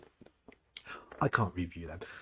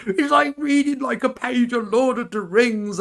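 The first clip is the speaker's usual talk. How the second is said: mock-outraged, much higher and louder; upset guest; 7 dB louder than usual. Upset guest scolding her review in a mocking high voice. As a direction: squeal it like an offended fan. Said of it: "the guest that got really upset".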